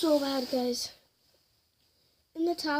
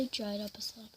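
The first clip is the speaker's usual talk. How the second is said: whispering; 3 semitones lower, 7 dB quieter, faster than usual. Real, not acted. Aside while cutting.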